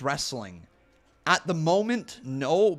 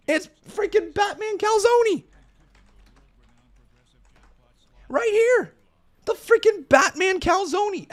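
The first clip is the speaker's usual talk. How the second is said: much higher and louder, outraged disbelief; exasperated shout; 9 dB louder than usual. Real, not acted. Streamer to his chat, realizing he was right all along, indignant shout. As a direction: yell it like you've been robbed of a win. Indignant outburst over calzone spelling.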